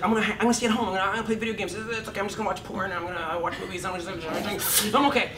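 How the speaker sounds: high, timid excuse-making voice; fear voice. Acted. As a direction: talk yourself into staying home. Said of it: "Which is the voice that's saying".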